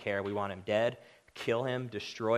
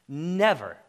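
Never punched higher and louder; emphatic never; 5 semitones higher, 9 dB louder than usual. Acted. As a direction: slam the word Never down as a final verdict. Emphatic denial in sermon.

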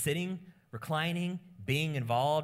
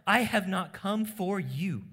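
stern Jesus; 6 semitones higher, 4 dB louder than usual. Retold or imitated. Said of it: "So Jesus says".

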